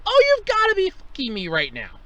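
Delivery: can't believe the pumps are coned off; disbelieving curse. Retold or imitated. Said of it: "And I'm like".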